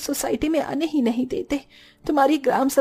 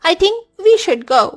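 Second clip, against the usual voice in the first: slow exit; 5 semitones higher, 8 dB louder, much slower than usual. Acted. Slow awkward exit line.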